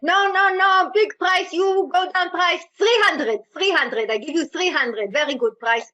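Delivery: put-on loud, high Israeli-accented bargaining that settles down; Israeli accent haggling. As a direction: push the price down in a brash Israeli accent. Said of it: "I was like".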